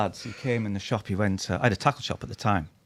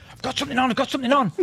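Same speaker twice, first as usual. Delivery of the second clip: higher, eager shouting; excited call; 13 semitones higher, much faster than usual. Retold or imitated. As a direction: angler hooking his third fish, pitched up. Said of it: "He's really excited now".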